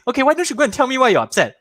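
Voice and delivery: quick, high, chirpy dad asking; mock cheerful dad prying; imitating dad. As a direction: rattle it off like a too-eager father. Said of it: "my dad goes and says".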